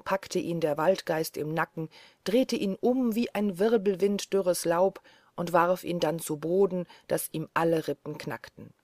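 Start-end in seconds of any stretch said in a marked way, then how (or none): none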